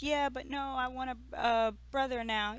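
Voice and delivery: a hint of a little girl changing her mind; little daughter. Daughter tells her mother she wants a brother now, lightly voiced child. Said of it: "But she's like".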